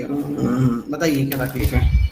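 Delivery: doctor asking. Retold or imitated. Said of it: "तो मैंने पूछा कि".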